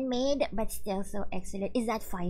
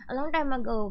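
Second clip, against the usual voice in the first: ordinary talk, nothing marked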